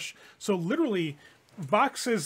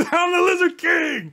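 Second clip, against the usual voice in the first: laughing, loud and high; triumphant shout; 14 semitones higher, 9 dB louder than usual. Real, not acted. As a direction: bellow it like a war cry. Player shouts catchphrase laughing.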